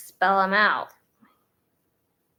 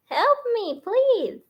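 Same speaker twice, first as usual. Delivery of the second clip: high, pleading cry for help; mock desperate plea; 7 semitones higher, 7 dB louder than usual. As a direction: beg heaven for help, hammed up.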